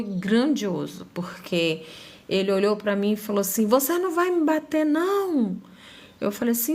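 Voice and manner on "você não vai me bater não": high-pitched, incredulous challenge; retold confrontational question; challenging father. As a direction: demand it in disbelief, voice pitched up. Acted quote of challenging father.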